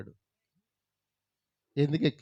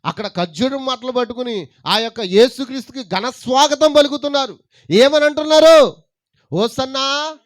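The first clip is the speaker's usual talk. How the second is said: building sermon fervor; 6 semitones higher, 10 dB louder than usual. Acted. Preacher leading the crowd toward hosanna, rising loud. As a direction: climb higher and louder toward the hosanna.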